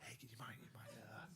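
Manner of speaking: polite, tentative whisper; barely audible hushed asking; retold discreet request. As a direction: whisper to a stranger in a theatre.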